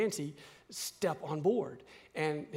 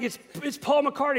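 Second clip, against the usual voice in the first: much higher, breathless excited name-drop; starstruck son; 8 semitones higher, 3 dB louder than usual. Retold or imitated. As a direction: stammer the name out in awe. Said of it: "he's like".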